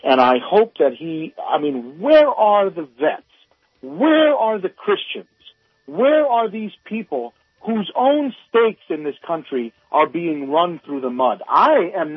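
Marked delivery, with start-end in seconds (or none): 2.0-9.0 impassioned rhetorical demand. Real, not acted. Caller's rhetorical outrage at vets.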